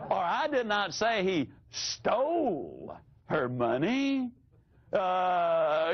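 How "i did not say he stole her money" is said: "stole" high and stretched, with "money" rising after it; stress on STOLE. Shown as a demonstration. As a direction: stretch "stole" out, leave the end hanging upward. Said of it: "he's gonna put it back".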